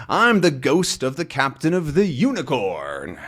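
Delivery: a hint of a storybook phantom; ghostly captain. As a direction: announce yourself as a ghost, stretching the last word. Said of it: "says the voice".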